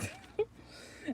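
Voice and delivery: airy high giggle; a short squeaky giggle; high breathy laugh. Real, not acted. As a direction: let a breathy laugh escape. Laughs at her own point.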